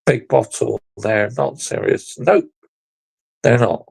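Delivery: normal talking voice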